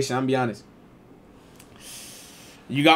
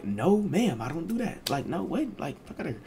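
quiet denial; 3 semitones higher, 9 dB quieter than usual. Retold or imitated. His answer to mom.

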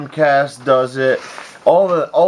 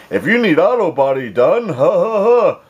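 higher, hammed-up ad read ending in a fake laugh; mock salesman voice; 6 semitones higher than usual. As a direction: parody a pushy sales letter. Mocks a letter from him.

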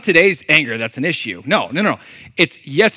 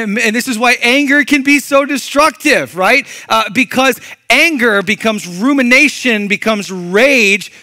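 higher and louder, pushing it home; fired-up repeat; 7 semitones higher, 6 dB louder than usual. Real, not acted. Pastor repeats to congregation, louder and higher. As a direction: hit it again with heat.

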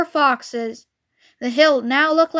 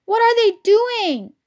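put-on high, drawn-out young fox's cry; 8 semitones higher than usual. A narrator's reading. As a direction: ask Dad in alarm, pitched up high. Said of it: "cried the small foxes".

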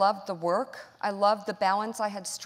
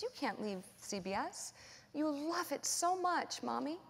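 put-on tearful child; 7 semitones higher, 10 dB quieter than usual. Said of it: "tears in them".

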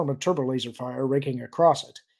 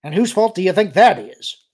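loud, higher accusing question; irritated, raised voice; snapping; 6 semitones higher, 10 dB louder than usual. A narrator's reading. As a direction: throw the blame back sharply. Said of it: "Carib snapped".

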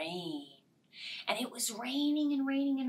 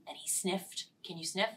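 usual speaking voice

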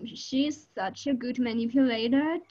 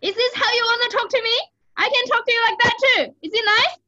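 loud, high, rapid-fire yell; out-yell a tantruming child, pitch way up; yelling back; 12 semitones higher, 8 dB louder, faster than usual. Acted. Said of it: "I'll yell at her louder".

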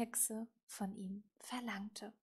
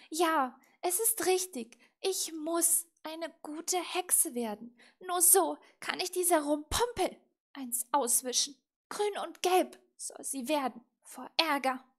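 loud, higher, emphatic vow; determined; 6 semitones higher, 9 dB louder than usual. A narrator's reading. Little witch vowing to outdo Rumpumpel, determined. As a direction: resolve firmly, relishing the coming revenge. Witch resolves to beat her rival.

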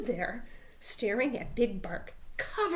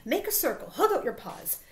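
high, hurried orders; rally everyone for a rescue; urgent yell; 7 semitones higher than usual. A narrator's reading. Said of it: "yelled Pip".

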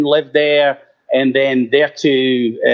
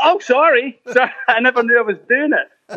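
higher, laughing sheepish sorry; sheepish apology; 10 semitones higher than usual. Retold or imitated. Caught calling at night, laughing sheepish apology. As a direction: apologise with a giggle. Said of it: "And I'm like".

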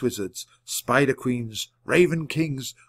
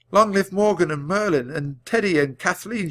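higher-pitched rallying cheer, held back; mild shout; 6 semitones higher than usual. A narrator's reading. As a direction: call out the roll of names like a toast. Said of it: "shouted Jack".

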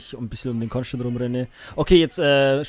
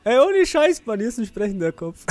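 excited; 8 semitones higher than usual. Real, not acted. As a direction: blurt it out in disbelief. Excited about talking head.

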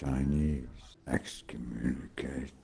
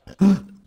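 loud startled gasp; sharp high gasp; 11 dB louder than usual. Real, not acted. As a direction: gasp in shock. Sudden alarm in game.

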